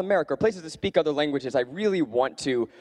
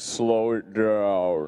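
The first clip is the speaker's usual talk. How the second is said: very slow, low-pitched drawl; deep slowed-down voice; 6 semitones lower, much slower than usual. Shown as a demonstration. Teasing how slowed speech sounds, deep and draggy. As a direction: drag it out, voice dropping deeper. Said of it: "your voice gets deeper".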